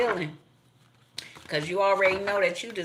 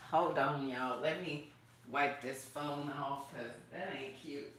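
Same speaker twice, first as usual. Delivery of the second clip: much softer, muttered to herself while wiping the phone; hushed aside mid-task; quiet aside; 4 semitones lower, 12 dB quieter than usual. Real, not acted. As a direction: drop the voice right down, an offhand aside.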